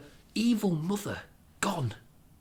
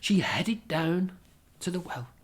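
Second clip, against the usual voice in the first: ordinary delivery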